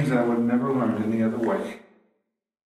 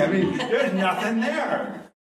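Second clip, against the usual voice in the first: laughing; 7 semitones higher, slower than usual. Real, not acted. Laughing about oyster lips.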